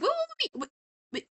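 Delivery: very high squeaky stammer; mock frantic plea. Charlie to Angel as he leaves, mock frantic. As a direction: imitate someone squeaking to stop a friend leaving.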